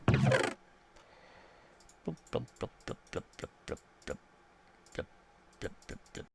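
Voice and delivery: whispered silly bloop noises; bloop sound effects. Pretend. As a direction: mouth little bloops as you place.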